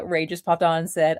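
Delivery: ordinary talk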